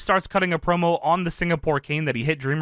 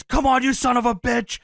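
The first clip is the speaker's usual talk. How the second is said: lightly acted higher-pitched taunt; defiant wrestler's challenge; 7 semitones higher than usual. Bleeding wrestler gets up to taunt his opponent, mildly defiant. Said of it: "Dreamer gets up and says".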